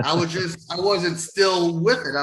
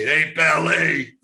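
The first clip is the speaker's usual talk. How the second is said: imitating Doctor Death; 4 dB louder than usual. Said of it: "as Doctor Death would say".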